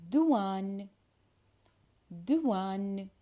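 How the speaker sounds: "douane" as doo-ahn with a w glide; little w sound. Shown as a demonstration. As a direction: model "douane" carefully, gliding oo into ahn. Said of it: "a little w sound".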